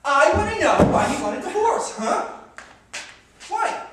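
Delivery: high, loud demand; agitated, pressing shout; hysterical. Acted. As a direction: insist on an answer at the top of your voice. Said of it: "when you're hysterical".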